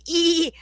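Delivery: high mocking squeal of delight. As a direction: squeal like a giddy fan. Mocking the woman's excited squeal.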